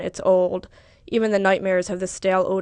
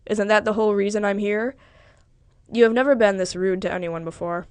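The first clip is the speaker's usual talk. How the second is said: usual speaking voice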